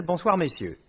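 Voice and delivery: ordinary talk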